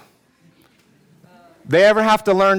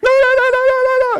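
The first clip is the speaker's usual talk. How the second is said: hammed-up high, loud panicked pleading; rapid-fire squealed mock protest; crying, freaking out; 6 dB louder, faster than usual. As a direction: imitate a child in a screeching panic. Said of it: "he starts crying".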